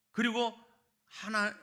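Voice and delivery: ordinary delivery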